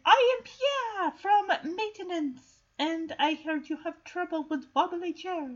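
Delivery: a hint of a French handyman; mock French accent. [name] posing as French maintenance man.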